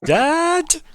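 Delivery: high loud call. Acted. Shopkeeper calling her dad upstairs, loud high yell.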